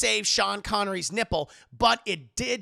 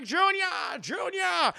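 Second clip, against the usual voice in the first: higher, slow shouted calling; urgent call; 9 semitones higher than usual. Retold or imitated. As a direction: call out to your son, reaching. Father reaches for son.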